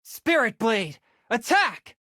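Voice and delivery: high, intent battle shout; battle cry. Acted. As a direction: call the attack as you unleash it. Ultimate attack callout.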